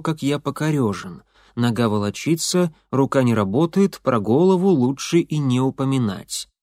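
ordinary delivery

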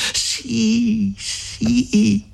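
high, anxious yielding; precipitate reply. A narrator's reading. Lucia rushes to give in to Renzo, precipitate reply. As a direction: give in at once, high-pitched. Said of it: "rispose precipitosamente Lucia".

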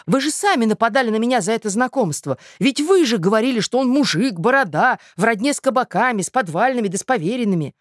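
high, insistent, amazed objection; astonished protest. A narrator's reading. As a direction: protest loudly in disbelief. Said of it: "в изумлении вскричал Мозгляков".

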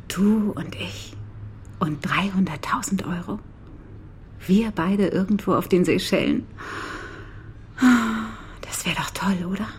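seductive whisper. Acted. Seductively tempting him to flee.

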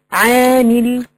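عامل with the aa held long and level; drawn-out long vowel. Shown as a demonstration. Showing the alif madd to students, vowel lengthened. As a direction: stretch the alif in عامل on one note. Example word with prolonged madd.

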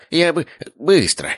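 high, slow, placating voice; meek eagerness. A narrator's reading. Uncle nodding to stern brother, meekly eager.

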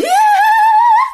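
very high eerie cackle; ghostly cackle. Retold or imitated. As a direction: cackle like mocking ghost women. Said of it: "vừa kéo vừa cười".

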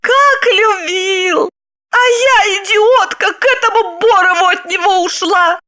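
high, drawn-out, near-sung rapture; rapturous exclaim. Acted. Wife rhapsodises about her ex's love, high and rapturous. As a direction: swoon over him aloud. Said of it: "восхищённо ... воскликнула".

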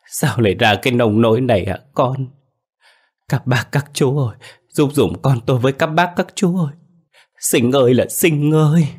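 high, loud wailing sobs; a mother keening for her lost son; sobbing mother. A narrator's reading. Mother of Sinh sobbing and begging the villagers for help. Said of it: "tiếng khóc nức nở".

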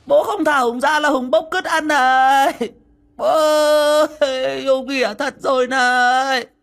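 high, loud, wild madman voice; a deranged son bellowing at his father; crazed shout. Acted. Madman Hùng shouting at his father from the locked room, wild and high.

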